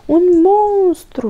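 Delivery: high, loud, slow terrified squeal; frightened squeak. A narrator's reading. Little hedgehog-friend Toni spots a monster, terrified squeak. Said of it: "chițăi Toni înspăimântat".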